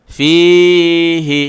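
ফিহি with the ই stretched long before the stop; long madd. Shown as a demonstration. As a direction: hold the ই of ফিহি three alifs. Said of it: "তিন আলি লম্বা করা যায়". (ফিহি শব্দের ই তিন আলিফ লম্বা করে)

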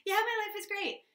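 high, overbright fake enthusiasm; peppy and upbeat. The Aries answering friends, peppy and upbeat. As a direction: gush like life is perfect. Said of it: "peppy and upbeat and excited".

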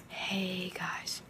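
soft breathy whisper. Pretend. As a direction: whisper it like a secret. Whispered greeting to camera.

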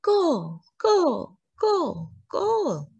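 high, chanted goal cheer; team cheering. A narrator's reading. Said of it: "Ali's team cheered".

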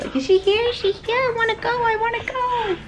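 very high puppy voice, whiny; voicing the dog. Owner speaking as her dog wanting to go, high puppy voice. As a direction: speak for the dog, eager and pleading.

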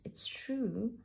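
usual speaking voice

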